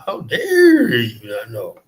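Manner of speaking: slow, loud, indignant; mock outrage. Streamer mocks offended callers from small countries in slow, loud mock outrage. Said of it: "All these people call from unimportant countries like".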